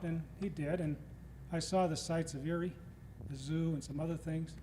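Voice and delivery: ordinary talk, nothing marked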